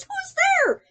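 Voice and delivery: high squeaky little mouse voice. Acted. Mouse complains of the cave, high squeak. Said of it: "Mouse cries".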